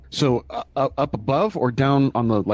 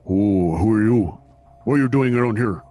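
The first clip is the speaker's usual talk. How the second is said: brute jailer voice. Acted.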